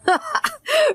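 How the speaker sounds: a sudden high laugh of disbelief. A narrator's reading. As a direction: burst into a short high laugh. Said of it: "He laughed".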